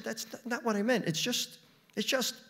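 high, halting self-defence; flustered. Acted. Husband stammers under attack.

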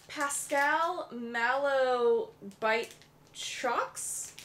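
slow, careful spelling-out read; reading it super slow. Real, not acted. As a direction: sound out each word slowly. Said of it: "I'm reading it super slow".

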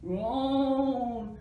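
loud, long, drawn-out groan; spooky groan. A narrator's reading. Reader sounding the noise in the haunted house, groaning. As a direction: groan like a storybook ghost.

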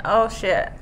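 ordinary talk, nothing marked

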